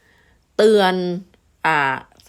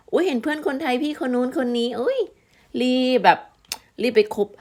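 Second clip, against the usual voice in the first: mock eager newbie; 6 semitones higher than usual. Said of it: "ไม่ใช่ว่า โอ้ย เห็นเพื่อนคนไทยนะ".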